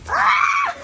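very high startled scream; surprised shriek. Real, not acted. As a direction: scream as you get soaked. Soaked by dog shaking.

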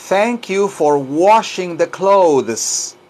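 slow, careful teacher enunciation; drawn-out language-lesson delivery; slow model sentence. Shown as a demonstration. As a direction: spell it out sound by sound for learners.

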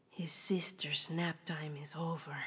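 very soft whisper; ominous whisper. Acted. Reader warning in a whisper, very soft. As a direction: whisper the coming danger.

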